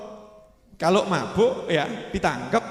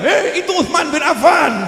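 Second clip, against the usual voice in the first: higher, louder, slowed shout; warning shout; 7 semitones higher, 5 dB louder than usual. Retold or imitated. Onlookers shouting that it is Uthman, loud slowed warning. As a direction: cry out to stop the beating.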